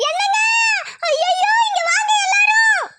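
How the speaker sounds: high piercing cry of alarm; panicked scream. Acted. Wife screaming for everyone after finding her husband, panicked. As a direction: scream for help on finding the body.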